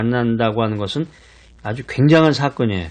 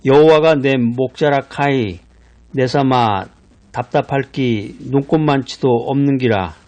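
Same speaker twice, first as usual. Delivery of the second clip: Gyeongsang dialect. Shown as a demonstration. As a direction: read the psalm like a Gyeongsang grandpa. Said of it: "시편 이십삼 편을 경상도 방언 버전으로".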